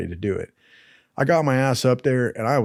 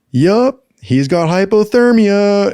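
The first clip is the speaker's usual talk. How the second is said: higher, louder, slow mock announcement; deadpan instructor verdict; 8 semitones higher, 5 dB louder, slower than usual. Instructors to the class, high loud slow verdict. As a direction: declare it like an obvious diagnosis. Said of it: "they're like".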